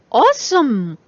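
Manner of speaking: bright put-on enthusiasm; forced cheer. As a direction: turn the cheer on like a faucet. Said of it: "back in character".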